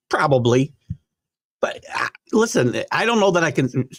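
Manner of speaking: normal talking voice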